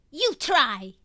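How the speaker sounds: put-on loud, high toddler order; a small child commanding her dad; bossy little girl. A narrator's reading. Little girl pushing a cookie at her father, ordering. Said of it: "she orders".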